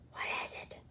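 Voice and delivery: hushed, breathless whisper; a scared little whisper; frightened whisper. Acted. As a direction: whisper so nobody hears. Nervous prairie dogs whisper.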